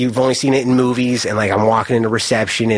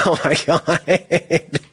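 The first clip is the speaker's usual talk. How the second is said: laughing disbelief; 4 semitones higher, much slower than usual. Retold or imitated. Cellmate says he killed last.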